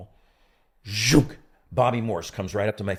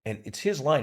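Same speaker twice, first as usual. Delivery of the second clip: ordinary talk, nothing marked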